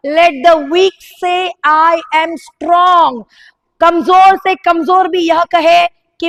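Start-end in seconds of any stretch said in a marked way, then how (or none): 0.1-3.2 very slow, each word drawn out; slow scripture reading. Shown as a demonstration. Pastor reads the scripture back to the church, very slow. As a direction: read the verse as if weighing every word. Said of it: "वहां पे क्या लिखा था?".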